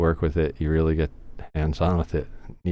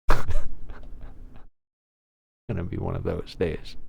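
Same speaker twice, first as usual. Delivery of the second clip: usual speaking voice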